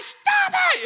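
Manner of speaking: very high, loud mock screech; fed-up little sister. Sister about to kick her brother, fed-up screech. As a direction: explode at a pestering brother. Sister at breaking point, acted.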